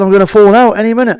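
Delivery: high, loud panicked cry; panicked yell. Retold or imitated. Clinging to the car, yelling at the driver in panic. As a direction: shout it as you slip.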